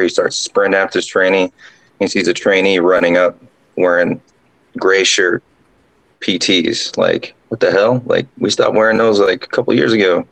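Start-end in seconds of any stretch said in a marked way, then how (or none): none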